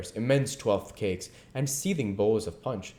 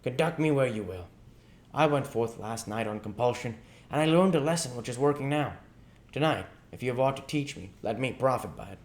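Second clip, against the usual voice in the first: higher, quick humble plea; submissive Scrooge; 5 semitones higher than usual. Acted. Scrooge asks the ghost to lead him, humble and quick. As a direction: ask humbly to be taught. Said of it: "said Scrooge submissively".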